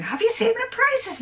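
high, incredulous question. Retold or imitated. Friends back from shopping, high incredulous question. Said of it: "came back saying".

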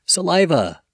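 Saliva said in full as suh-LYE-vuh; Saliva, three clear syllables suh-LYE-vuh, falling; slow clear dictionary pronunciation. Shown as a demonstration. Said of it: "break it down syllable by syllable".

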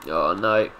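ordinary talk

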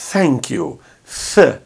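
soft English th, tongue at teeth; soft th demo. Shown as a demonstration. Teacher models a gentle th for students. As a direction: thank you and th, soft breathy th. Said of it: "bem suave, de".

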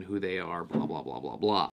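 normal talking voice

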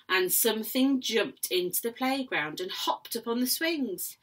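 ordinary delivery, nothing marked

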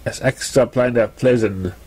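ordinary delivery, nothing marked

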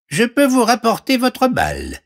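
very high frog voice; squeaky-high frog offering help; talking frog. Acted. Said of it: "vit une grenouille". (voix de grenouille très aiguë)

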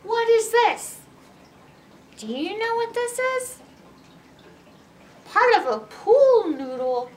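plain reading voice

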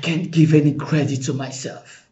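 ordinary talk, nothing marked

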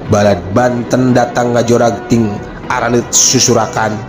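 ordinary delivery, nothing marked

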